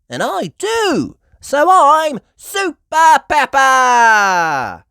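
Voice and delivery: high, loud, slow triumph; proud declaration. A narrator's reading. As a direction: announce it proudly. Peppa proud speech.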